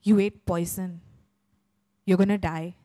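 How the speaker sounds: a hint of an ominous mother; stern mother. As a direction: pronounce doom like a stern parent. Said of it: "And she's like".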